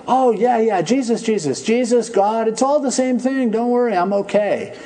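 carefree shrug in the voice; breezy brush-off. Retold or imitated. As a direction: wave it off lightly like a dismissive dad. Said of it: "he would say".

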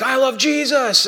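high, slow mock rival chant; imitated kids' shouting. Kids on one side of the room, mock shouting. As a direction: pitch it up like children shouting a slogan. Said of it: "they're shouting at each other".

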